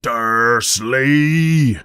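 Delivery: drawn-out exploding call; angry yell. A narrator's reading. Giant explodes at the uncle, yelling. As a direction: yell the name, about to explode. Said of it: "he yells".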